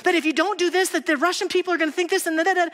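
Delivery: a mock-anxious attorney piling on worries; anxious Donovan. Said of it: "and then he'd say".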